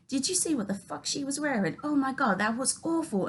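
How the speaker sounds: high mock-British gossiping voice; catty put-on British accent. Mom in the car ride home, catty British accent. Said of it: "Let me do the British accent".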